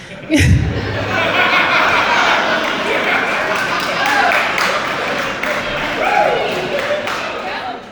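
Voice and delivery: breathless, airy burst of laughter; real laugh. Real, not acted. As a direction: laugh it out, unable to hold it in. Laughs after mentioning Craig.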